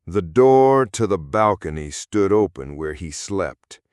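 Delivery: plain reading voice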